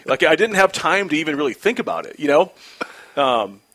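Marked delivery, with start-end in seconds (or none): none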